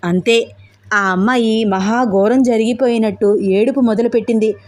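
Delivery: plain reading voice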